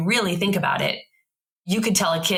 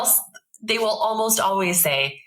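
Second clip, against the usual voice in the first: ordinary talk, nothing marked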